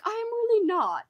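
high-pitched mock British accent. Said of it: "it was in a British accent".

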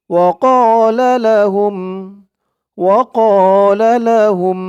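slow recited وقال لهم with a long alif; Quran recitation. Shown as a demonstration. Teacher reciting for students to repeat. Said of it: "আমার সাথে সাথে ঠোঁট নাড়িয়ে বলবেন".

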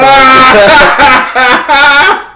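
loud, high burst of laughter; shrieking laugh. Real, not acted. Storyteller cracks up during his sign-off, real shrieking laughter.

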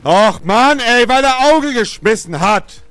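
loud furious complaint; berserk rage. Real, not acted. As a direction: rant at the game in fury. Angry at the opponent's roll.

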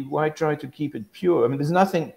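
ordinary talk, nothing marked

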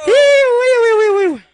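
loud high iu iu whoops; high whooping. Real, not acted. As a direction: whoop like a party crowd. Celebratory whoops.

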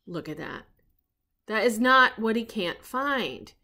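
usual speaking voice

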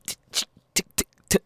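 clicking tick sounds spilling out uncontrollably; vocal tic. Acted. [name]'s Tourette's tic breaking through his talk, clicking ticks. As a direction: tic it out in a rapid, stuttering burst. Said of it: "I have Tourette's".

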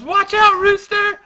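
high, loud acted warning; shouted warning. Warning Rooster of danger, high urgent shout. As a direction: call out the danger sharply. Manga character calls warning.

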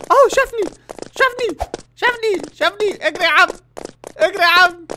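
high, loud panicked shouts; panicked yelling. Real, not acted. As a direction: scream that he's spotted you. Chased by the prison cook.

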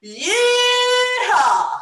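high cowboy whoop; Cowboy Cal. Pretend. Host presenting the cowboy, whooping high. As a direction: holler it on a long held note. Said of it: "Cowboy Cal".

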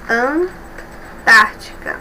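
Antártica drawn out very slowly, syllable by syllable; Antártica said very slowly so pupils can count its syllables; slowly stressing each syllable. Shown as a demonstration. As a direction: stretch out Antártica to show the stress on -tár-.

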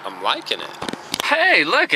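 normal talking voice

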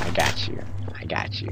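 soft reassuring whisper. Real, not acted. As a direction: whisper it to calm them.

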